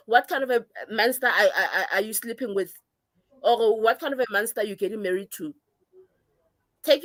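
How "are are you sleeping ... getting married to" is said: ordinary talk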